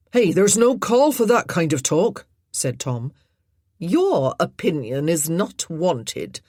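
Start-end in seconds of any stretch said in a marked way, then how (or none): 0.1-2.3 indignant. Acted. Tom argues with the lady. 3.8-6.4 icy, slow and haughty; freezing cold. Acted. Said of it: "said the woman in freezing accents".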